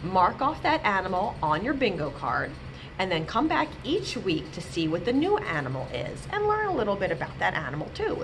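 usual speaking voice

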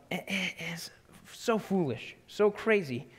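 ordinary talk, nothing marked

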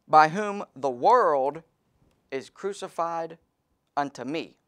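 slow, drawn-out verse reading; slow scripture reading. Acted. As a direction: stretch each word of the verse out. Preacher reads the verse slowly.

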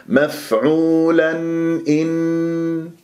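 "مفعولا إن" chanted slowly with the n sounded clearly; drawn-out recited "مفعولا إن", no merging of n; clear noon. Shown as a demonstration. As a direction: sound the tanween n cleanly before the hamza in "مفعولا إن". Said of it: "لازم أظهر".

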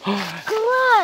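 loud joyful cry; excited shout. Real, not acted. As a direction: call out the find, thrilled. Child sees the treasure.

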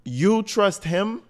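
high, slow, disbelieving; you trust him, really; incredulous. Real, not acted. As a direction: drag it out in disbelief. Incredulous question to Sarah.

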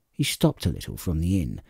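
ordinary delivery, nothing marked

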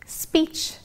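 ordinary talk, nothing marked

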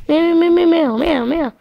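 high, rapid cat meows; a cat yowling at the fire; cat meowing. Acted. Cat yowling at the fire, high-pitched meows.

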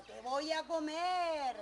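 slow, loud, high threat; ogre's roar. Acted. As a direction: bellow it as a storybook ogre, drawn out. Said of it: "el ogro salió y dijo".

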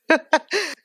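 short high giggle; a quick amused laugh; light laugh. Real, not acted. Speaker laughing about grandma's friends, a short high laugh.

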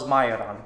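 majoran as "MY-oh-ran"; Slovak pronunciation. Shown as a demonstration. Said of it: "That's how I pronounce it in Slovakia".

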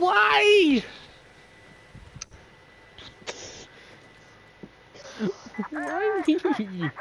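high drawn-out whine; hammed-up high whining lament; mock wail. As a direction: wail to the heavens in mock despair. Wailing in mock despair.

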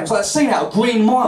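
ordinary delivery

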